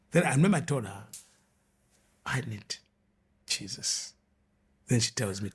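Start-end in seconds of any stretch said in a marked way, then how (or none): 2.3-4.1 hushed plea. Retold or imitated. Confessing need, hushed.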